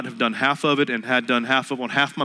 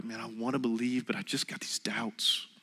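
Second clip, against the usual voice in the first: whispered, unvoiced and soft; hushed doubter's confession; 11 dB quieter than usual. Preacher voicing a doubting listener, whispered confession. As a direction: breathe it like a secret fear.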